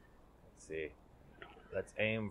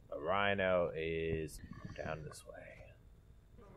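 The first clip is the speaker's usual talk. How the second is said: slow hunter's whisper; 3 semitones lower, 6 dB quieter, much slower than usual. Acted. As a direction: hush it like stalking prey. Sneaking up on a rhino.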